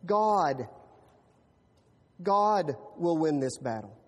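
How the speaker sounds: solemn confident David; solemn conviction. Retold or imitated. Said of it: "He told Goliath".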